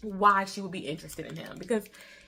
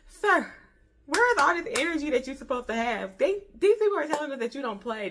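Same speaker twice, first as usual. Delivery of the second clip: higher and louder, fast and exasperated; incredulous rant at the character, faster; exasperated challenge; 7 semitones higher, 5 dB louder, faster than usual. Pretend. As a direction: demand answers from a disappointing hero.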